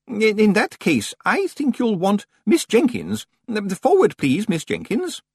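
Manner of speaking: high, slow, halting librarian voice; hesitant senior librarian. Acted. Senior librarian calling assistant forward, high and halting. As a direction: summon politely with drawn-out hesitation.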